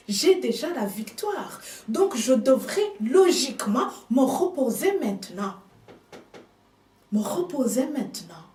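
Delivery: normal talking voice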